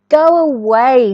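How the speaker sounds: loud, slow brush-off; rude ducklings. Acted. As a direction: push him away loudly. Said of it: "said the fluffy yellow ducklings".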